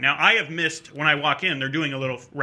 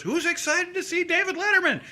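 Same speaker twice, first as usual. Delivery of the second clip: high-pitched hype-man call; crowd hype. Acted. Page hanging over a stairwell to the waiting audience, high excited call.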